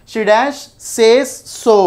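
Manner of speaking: very slow, word-by-word reading; slow dictation. Shown as a demonstration. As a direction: read the question out slowly, spacing each word. Reading out a question for students. (ప్రశ్నను నెమ్మదిగా, పదం పదంగా చదవడం)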